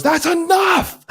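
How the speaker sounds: sudden high, slow furious shout; high drawn-out angry outburst; Sheridan's shout. Retold or imitated. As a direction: bellow it like a leader who's had enough. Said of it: "where he goes".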